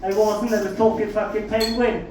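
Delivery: normal talking voice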